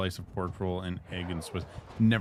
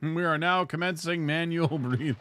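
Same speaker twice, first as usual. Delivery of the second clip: laughing announcer voice; mock announcer; 7 semitones higher, 4 dB louder than usual. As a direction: announce it grandly while laughing. Mock announcement about breathing.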